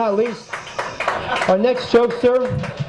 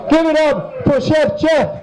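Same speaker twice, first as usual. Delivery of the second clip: higher, slow announcer shout; emcee shout; 6 semitones higher, slower than usual. Real, not acted. Host bringing Chef Jeff up to tell jokes, emcee shout. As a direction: shout the act onto the stage. Host calls up next comic.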